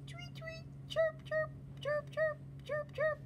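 very high chick squeaks; very high squeaky chick chirps; squeaking chicks. Acted. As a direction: chirp like tiny baby birds. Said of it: "squeaked the chicks".

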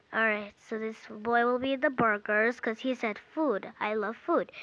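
usual speaking voice

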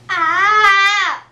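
sharp real yelp of pain; pained cry. Real, not acted. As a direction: cry out as tape rips. Tape pulled off, pain.

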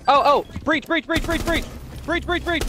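high, urgent repeated shout; frantic high callout. Real, not acted. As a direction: yell the warning in a panic.

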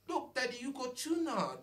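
put-on high little-girl voice with an odd accent; imitating toddler daughter. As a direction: play the three-year-old showing off her find. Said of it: "Does your daughter have an accent?".

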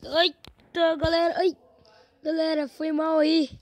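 loud startled shout; startled yell. Real, not acted. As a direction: yelp in sudden alarm. Sudden mishap, yelling.

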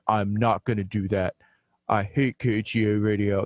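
low, subdued mock refusal; Kurt voice. Teasing a co-worker over the hot dog bull ride, mock-sulky impression. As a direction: lightly impersonate a grumbling co-worker, low and soft. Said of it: "That's my Kurt voice".

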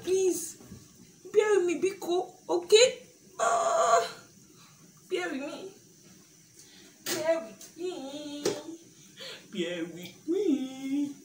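crying from onion fumes, high; fighting onion tears, slowing down; teary from chopping onions. Real, not acted. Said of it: "these onions is making me cry".

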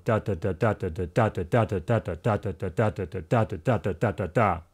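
"da" chanted steadily, switching between groups of three and groups of two; alternating groupings. Shown as a demonstration. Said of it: "every two beats would be the next direction".

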